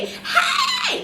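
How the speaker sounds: very high, shrieking excited hey; squealing hey. Retold or imitated. Introduced on the show, a very high shrieking hey with a laugh. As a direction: shriek it like you just got introduced. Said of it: "you say".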